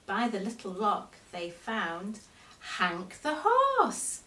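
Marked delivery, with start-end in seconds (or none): 2.8-4.2 excited reveal. A narrator's reading. Reveal of found toy.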